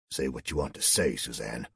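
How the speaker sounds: low, faintly testy reply; almost testy. A narrator's reading. Colonel lets his subordinate speak, almost testily. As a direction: drop the pitch, a touch of irritation. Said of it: "replied almost testily".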